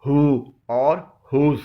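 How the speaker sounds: who and whose said with a clear h, very slow; h pronounced. Shown as a demonstration. Teacher slowly demonstrates who and whose. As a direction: stretch out who and whose, h sounded. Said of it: "ایچ خاموش نہیں ہوتا بلکہ بولا جاتا ہے".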